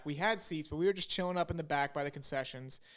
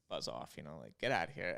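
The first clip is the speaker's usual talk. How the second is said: much lower and softer, a dismissive mutter; mock brush-off; 11 semitones lower, 7 dB quieter than usual. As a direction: brush the kid off in a low, lazy grumble. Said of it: "we were like".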